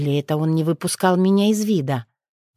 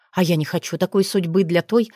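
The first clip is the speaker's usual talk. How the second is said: plain reading voice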